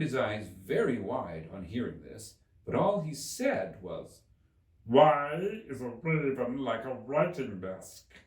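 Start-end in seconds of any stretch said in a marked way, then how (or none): 4.8-8.1 slow, louder riddling Hatter. Acted. Hatter asking Alice his riddle, slow and loud. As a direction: pose the riddle slowly and loud.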